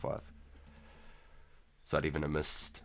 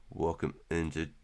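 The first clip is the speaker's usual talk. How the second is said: ordinary talk, nothing marked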